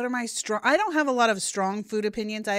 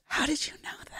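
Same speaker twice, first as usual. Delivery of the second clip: whispering; 7 semitones higher, 5 dB quieter, faster than usual. Real, not acted. Aside to host during trivia.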